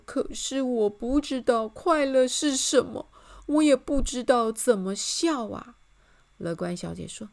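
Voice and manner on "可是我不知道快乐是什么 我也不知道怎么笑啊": higher, louder helpless whine; troubled. A narrator's reading. King does not know how to laugh, troubled. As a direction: admit it in a helpless voice. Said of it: "很为难地说".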